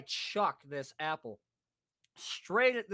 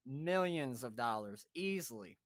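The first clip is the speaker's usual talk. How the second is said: ordinary talk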